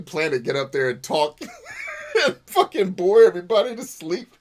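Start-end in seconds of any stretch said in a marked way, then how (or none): 1.8-4.3 loud words broken by laughter; laughing while ranting. Real, not acted. Mocking the graduation speaker, laughing loudly.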